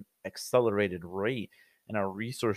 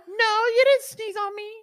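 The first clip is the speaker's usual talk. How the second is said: put-on much higher kid protesting; high child voice; 4 dB louder than usual. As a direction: whine back like an indignant child.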